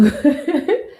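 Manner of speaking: quick high burst of laughter; laugh, caught off guard; surprised laugh. Real, not acted. Surprised by sudden question.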